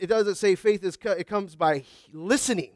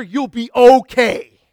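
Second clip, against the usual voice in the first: mocking retort; 6 semitones higher, 12 dB louder, slower than usual. Mocking cancer reassurance.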